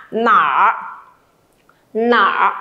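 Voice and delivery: drawn-out "Nả" in a low dipping tone, r-coloured; curled-tongue ending. Shown as a demonstration. Teacher asks students to curl the tongue, r-coloured "Nả". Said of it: "Cong lưỡi lên cho cô nào".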